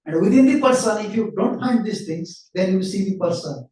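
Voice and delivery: ordinary talk, nothing marked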